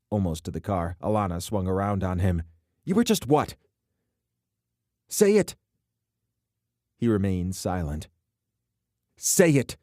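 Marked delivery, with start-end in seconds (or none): 2.8-5.6 high, loud furious demand; rising furious confrontation; angry demand. A narrator's reading. Alana confronts him. 9.2-9.8 high, loud insistent demand; repeated furious order; angry demand. A narrator's reading. Alana repeats her demand.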